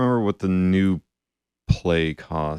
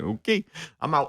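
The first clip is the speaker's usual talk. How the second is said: mock bailing out; 7 semitones higher, 4 dB quieter than usual. Guest retells quitting the video, mock bailing out. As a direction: toss it off high, as if checking out. Acted reaction, giving up on video.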